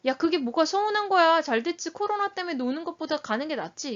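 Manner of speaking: acted parent waving away a child's disappointment; dismissive parent. Shown as a demonstration. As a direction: scoff at the complaint, pitch up. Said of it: "이건 전적인 수용이 아닌 거예요".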